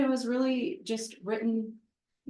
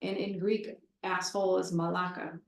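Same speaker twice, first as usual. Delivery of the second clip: ordinary talk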